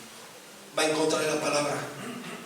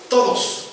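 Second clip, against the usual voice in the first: todos hit hard and louder; stress on todos; 5 semitones higher, 4 dB louder than usual. Shown as a demonstration. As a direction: punch the word todos. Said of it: "Diga conmigo".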